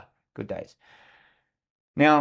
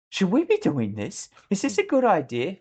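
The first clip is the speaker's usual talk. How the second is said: higher, unsure, worried asking; a leader openly losing his nerve, higher; anxious doubt; 9 semitones higher, 4 dB louder than usual. Pretend. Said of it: "imagine if I had just leant over to my sniper".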